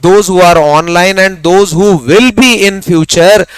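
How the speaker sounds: very slow and deliberate; teacher addressing online viewers; slow emphatic. Shown as a demonstration. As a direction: address every viewer slowly and pointedly. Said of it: "जोर डाल के सबको बोल रहा हूं".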